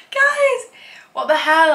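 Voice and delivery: high squeal of alarm; shocked outcry. Real, not acted. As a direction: call out high in disbelief. Shocked at overdone shimmer.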